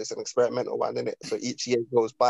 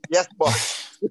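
mock obedient; 5 semitones higher, 4 dB louder than usual. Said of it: "That sounds like".

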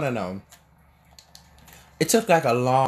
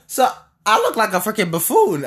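excited exclaim; 7 semitones higher, 5 dB louder than usual. Real, not acted. Laughing at his old haircut.

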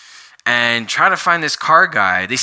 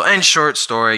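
ordinary talk, nothing marked

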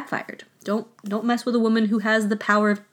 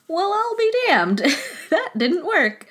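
much higher, hammed-up stunned voice, chuckling at the end; mock dumbfounded captain; 9 semitones higher than usual. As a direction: play a baffled captain watching his plan fail, high and amused. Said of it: "sitting there like".

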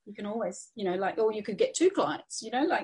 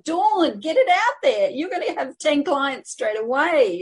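much higher and louder, rallying; excited cheer; 6 semitones higher, 6 dB louder than usual. Real, not acted. Coach urging viewer Dawn to launch, real excited cheer. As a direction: shout encouragement across the room.

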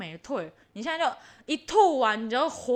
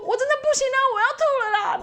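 much higher, louder whining; whiny mock plea; 12 semitones higher, 4 dB louder, much faster than usual. On the scooter, whining to her husband that she'll throw up. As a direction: whine like you can't hold it in.

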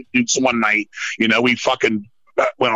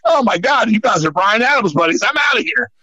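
much higher, hammed-up fed-up exit; mock exasperation; 7 semitones higher than usual. Leaving two guys chatting about [name], mock exasperation. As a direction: throw up your hands and leave.

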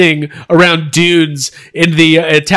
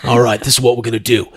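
low, quiet, fast Cockney patter; hard-man Cockney boast, rushed; Jason Statham impression; 7 semitones lower, 5 dB quieter, faster than usual. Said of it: "He's like".

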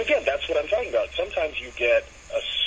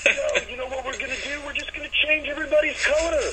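mocking producers; 11 semitones higher than usual.

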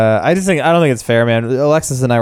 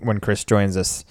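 ordinary talk, nothing marked

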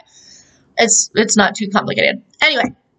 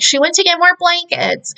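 put-on little kid; 8 semitones higher than usual. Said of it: "little Rudiger is like".

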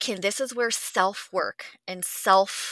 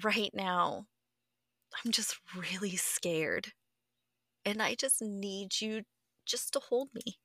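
soft plea; 8 dB quieter than usual. Retold or imitated. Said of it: "go to Chris and say".

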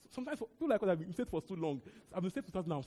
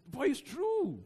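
higher and louder, still laughing; laughing insistence; 8 semitones higher, 5 dB louder, much slower than usual. Real, not acted. Preacher insisting on the truth to laughing congregation, amused and emphatic. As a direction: push the point through the laugh.

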